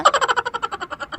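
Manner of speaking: high falling giggle; high laugh. Acted. Laugh after confession. (ऊँची हँसी)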